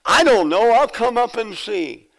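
loud, high-pitched comic shout; hammed-up holler, pitched high; yelling back. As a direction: act out calling up to a sister. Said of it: "ninety-four-year-old sister yells back".

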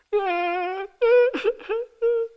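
a very high, drawn-out crying whine; mock toddler whining wail; imitated whine. Shown as a demonstration. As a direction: let out a long childish wail like a kid on the floor. Imitating a whine after defining it.

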